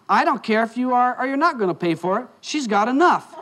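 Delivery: high-pitched, adamant refusal; retold waitress refusing to budge, pitched well up. Waitress overruling the boyfriend's offer to pay, adamant. Waitress snaps back in quarrel.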